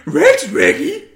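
loud, high, drawn-out cartoon dog voice; Scooby-Doo. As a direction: yelp the words slowly like a cartoon dog. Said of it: "doing a Scooby-Doo impression".

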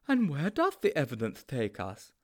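high-pitched, naive sidekick; high storybook character voice; Sponge's character voice. A narrator's reading.

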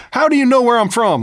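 high, loud shocked question; surprised tourist. Retold or imitated. The tourist gasps at him, high and loud. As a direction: squeal it like a stunned tourist. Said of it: "they're like".

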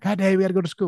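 high-pitched imitation of eager kids; kids waking Dad.

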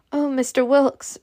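a touch of desperation, pleading; desperate cry. A narrator's reading. Scarlet pleads with the old man leaving, desperate cry. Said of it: "she cried desperately".

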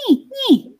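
little nagging nee nee; naggy bird call. Shown as a demonstration. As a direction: mimic a nagging cuckoo. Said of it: "That little naggy".

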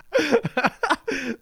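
sudden high laugh at his own joke; cracking up high; high burst of laughter. Real, not acted.

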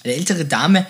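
ordinary delivery

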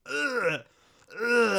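hammed-up retching groans; fake sick groans. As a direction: groan like you're about to throw up. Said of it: "making noises".

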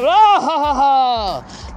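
loud mock guffaw; tiger still laughing. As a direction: laugh heartily at two tiny attackers. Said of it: "he's still like".

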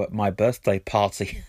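ordinary talk, nothing marked

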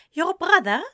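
lightly voiced Spanish-accented woman. A narrator's reading. Anathema asking Eliza about her brother, Spanish accent. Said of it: "asked in an accent that was a different sort of Spanish".

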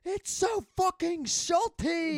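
very high, slow, loud chef scream; mock a chef exploding at adults; Gordon Ramsay. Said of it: "the adults, he's like".

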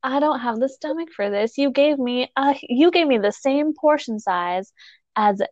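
usual speaking voice

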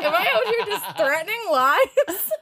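high, laughing disbelief at her own rage. Retold or imitated. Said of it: "I'm like".

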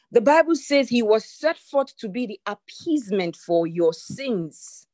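ordinary talk, nothing marked

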